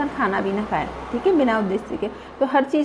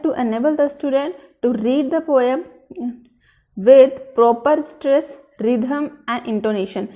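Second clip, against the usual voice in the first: crawling pace, each word set apart, louder on with proper stress; slow dictation; 4 dB louder, much slower than usual. Shown as a demonstration. Teacher dictating the specific aim to her trainees, slow and deliberate. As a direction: read it out slowly so the class can copy it.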